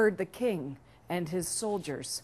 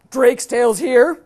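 loud, slow royal outburst; outraged king; 4 semitones higher, 14 dB louder than usual. Acted. King hears his creditor has arrived, loud outrage. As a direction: boom it out in indignation.

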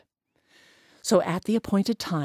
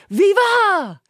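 louder, much higher cheer; calling out; 12 semitones higher, 6 dB louder than usual. Acted. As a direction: hail him from the gate. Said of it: "they called out".

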